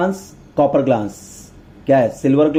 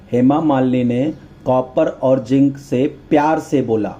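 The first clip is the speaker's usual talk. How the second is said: slow, word-by-word recitation; slow teacherly repetition; drawn-out mnemonic; slower than usual. Shown as a demonstration. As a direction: spell out the memory phrase slowly, dropping at the end. Mnemonic repeated slowly for students.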